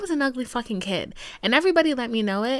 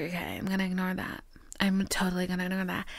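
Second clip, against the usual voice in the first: hushed, sly whisper to herself; whispered self-talk; 4 semitones lower, 8 dB quieter, faster than usual. Retold or imitated. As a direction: murmur it like denial. Telling herself to ignore it.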